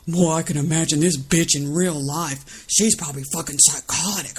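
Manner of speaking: lightly voiced sneering heckler; mocking quote. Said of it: "'Cause Epaphras goes".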